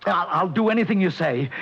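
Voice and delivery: high, desperate begging; frantic pleading. Acted. Client begs Barney not to back out, high and frantic. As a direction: clutch at him, panicking.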